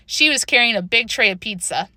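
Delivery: plain reading voice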